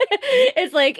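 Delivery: laughing through the words. Real, not acted. Friend laughing while talking about flying it. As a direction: breaks into a laugh mid-phrase.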